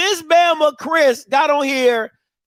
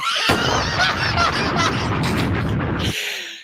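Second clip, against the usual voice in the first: long, very high laugh; helpless high-pitched laughing fit; cracking up; 4 dB quieter than usual. Real, not acted. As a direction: collapse into laughter. Cracks up reading the reply.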